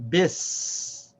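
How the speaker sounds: BIS as one syllable, held hissed s; joined syllable. Shown as a demonstration. Teacher joins seen to ba, bis as one syllable. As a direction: run b-i straight into a long "s" in bis. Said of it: "connect the sound of the seen with the ba".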